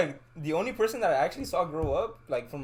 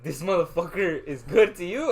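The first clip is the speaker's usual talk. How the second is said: loud, higher incredulous question, half laughing; retold disbelief; 4 semitones higher, 7 dB louder than usual. Teammate complains to friend about the new player, in loud disbelief. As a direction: act out laughing, raised-voice disbelief. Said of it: "I couldn't believe that shit".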